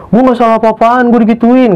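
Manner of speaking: high-pitched mock tearful protest; menirukan tangisan. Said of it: "langsung kayak nangis". (menirukan rengekan orang menangis)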